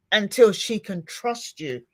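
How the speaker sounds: usual speaking voice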